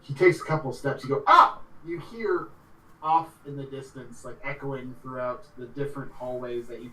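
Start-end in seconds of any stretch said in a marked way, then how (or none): 1.3-1.6 short high, loud cry; sudden startled yelp. Acted. Sudden cry voiced in game.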